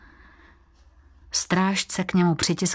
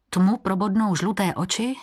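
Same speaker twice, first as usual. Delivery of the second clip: usual speaking voice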